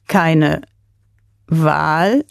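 WAHL drawn out, rising into a question; slow, puzzled repetition. A narrator's reading. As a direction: repeat "Keine Wahl" slowly, as if working out what it means. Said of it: "wiederholte der Russe langsam, als versuchte er, die Bedeutung der Worte zu begreifen". (langsam fragend wiederholt, "Wahl" steigt am Ende)

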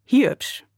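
"hiebsch" with ee in place of ü; Russian-accented hübsch. A narrator's reading. Russian soldier judging the woman, accented "hiebsch". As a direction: say "hiebsch", the ü unrounded to a long ee. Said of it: "Oder „hiebsch".

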